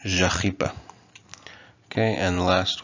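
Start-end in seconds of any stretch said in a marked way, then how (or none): 0.0-0.7 "Jachipa" with zh and throaty ch; Kotava pronunciation. Shown as a demonstration. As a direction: say "Jachipa" as zha-KHEE-pa. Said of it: "we have the j".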